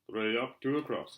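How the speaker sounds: plain reading voice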